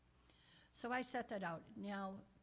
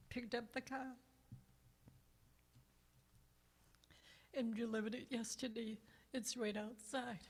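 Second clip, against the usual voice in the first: fighting back tears of gratitude; emotional, tearful telling; happy tears; 3 semitones higher than usual. Real, not acted. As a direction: voice rising high with joyful tears, breaking into laughs. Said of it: "sorry for the tears".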